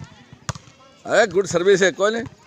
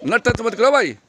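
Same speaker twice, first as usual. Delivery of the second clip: coach shouting; 9 semitones higher, 6 dB louder than usual. Real, not acted. Coach calling to jumping kids.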